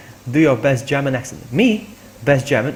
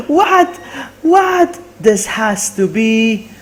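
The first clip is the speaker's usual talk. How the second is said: very high, loud astonishment; stunned disbelief; 9 semitones higher, 5 dB louder, much slower than usual. Real, not acted. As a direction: squeal it in amazement, then stretch the words. Still stunned by the raid.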